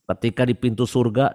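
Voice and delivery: ordinary talk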